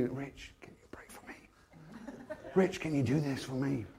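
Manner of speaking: soft, whispered plea; a hushed little request for help; pleading. Retold or imitated. Tough men quietly begging Rich to pray, whispered.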